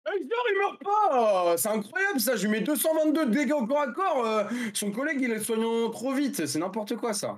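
can't believe the enemy survived; indignant disbelief. Real, not acted. Enemy survives his attack.

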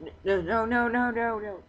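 high, loud frantic protest; panicked no. Real, not acted. Gamer begging a walking-away villager to stay, loud and frantic. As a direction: yell no over and over to stop her leaving.

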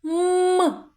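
"М" as a long high hum; isolated sound. Shown as a demonstration. Teacher answers the next sound, long high hummed М. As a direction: hum a closed-lip "М". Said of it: "Друкуємо звук М".

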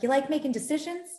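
a brash New York uncle teasing; New York accent. Retold or imitated. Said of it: "thick New York accent".

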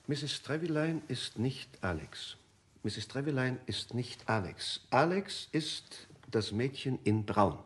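slow, deliberate reading of a note. Acted. Davis slowly reads the strange note to Temple. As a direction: spell out the note word by word.

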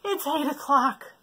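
a hint of a sleepy yawn; sleepy elephant, lightly voiced. Elephant yawns at bedtime, a touch sleepy. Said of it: "yawned the elephant".

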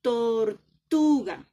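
Tortuga very slow and loud, split Tor-TU-ga, TU strong; stress on TU. Shown as a demonstration. Teacher leading pupils to pronounce, Tortuga stressed on TU. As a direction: draw out Tortuga, hitting TU hard. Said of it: "una sílaba que suena más duro".

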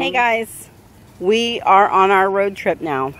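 ordinary delivery

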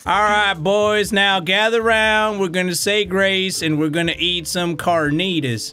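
cowboy saying grace. Acted. Cowboy leads grace mockingly.

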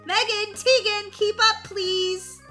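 high, loud summons; calling out. Acted. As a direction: call impatiently to lagging kids. Sitter calls to lagging kids.

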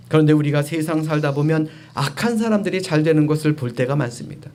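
normal talking voice

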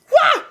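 sudden high, loud "wah!"; startled yelp. Retold or imitated. Storyteller reliving the sight of the spider, startled yelp. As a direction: yelp in mock fright.

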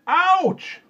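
very high, loud pained yell; piercing, falling yelp. A narrator's reading. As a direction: cry it out at full volume. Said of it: "He said it again, louder".

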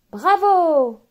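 animals' bright bravo cheer. Acted. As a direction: cheer like a crowd of happy animals. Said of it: "applaudissent les animaux".

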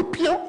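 high pew for the lights going out; power-out sound effect. Retold or imitated. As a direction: squeak the sound of power dying. Imitating the power cutting.

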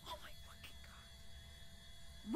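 slow, hushed, breathless gasp; very soft scared whisper; frightened whisper. Real, not acted. Scared in a tense moment.

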